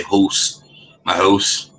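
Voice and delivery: "house" with a rounded vowel, like "hose"; Canadian pronunciation. Retold or imitated. Imitating Canadians saying house, "house" as "hohse". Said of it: "heard them say the word house".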